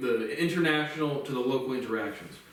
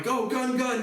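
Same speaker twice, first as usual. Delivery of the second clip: high, urgent mock shout of cops; imitating cops yelling a warning; retold cop yell; 9 semitones higher, slower than usual. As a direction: call it out like a panicked cop. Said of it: "the other cops are like".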